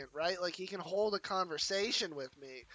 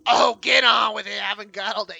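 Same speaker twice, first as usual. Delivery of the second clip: loud, impatient yell; impatient shout; 13 dB louder than usual. Real, not acted.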